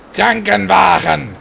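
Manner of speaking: loud, drawn-out angry German yell; mock angry German shout. Gamer proving you must be angry to speak German, mock furious shout.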